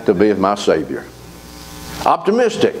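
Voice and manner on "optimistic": a ringing high declaration; emphatic proclamation. Real, not acted. Preacher proclaiming his outlook, high and ringing. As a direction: sing out the word. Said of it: "preacher proclaiming".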